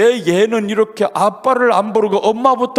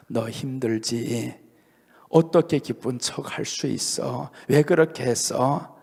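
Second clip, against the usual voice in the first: much softer, slow and low, tender; God's tender voice; 5 semitones lower, 7 dB quieter than usual. Retold or imitated. God speaking to a struggling believer, soft and tender. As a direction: murmur it like God speaking inside the heart. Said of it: "하나님이 들려주는 음성이 있어요".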